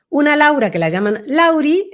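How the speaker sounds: "Lauri" lifted high at the end; voice going up. Shown as a demonstration. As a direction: let the voice climb up on "Lauri". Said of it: "la voz se le va para arriba".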